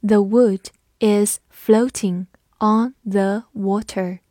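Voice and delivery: slow, careful model reading; teacher reading slowly for the class. Shown as a demonstration. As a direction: read it slowly word by word. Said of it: "我们慢读一遍".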